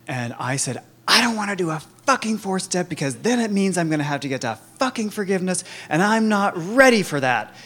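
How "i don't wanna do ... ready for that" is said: higher, louder, fast, cursing refusal; reliving a furious no to the sponsor; angry outburst. Retold or imitated. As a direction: spit it out, fed up and defiant.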